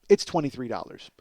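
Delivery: ordinary talk, nothing marked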